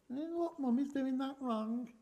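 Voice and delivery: childlike mock scolding; little child's voice. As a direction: voice her small child teasing mummy. Child's remark voiced by mum.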